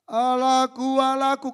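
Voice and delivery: anguished cry. Acted. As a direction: cry out high and loud, full of anguish. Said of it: "Dia teriak". (seruan keras penuh derita)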